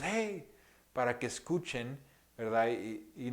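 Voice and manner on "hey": put-on sharp high call to the kids; a parent's warning call, higher; imitated warning shout at children crossing. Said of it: "yo les voy a gritar a mis hijos".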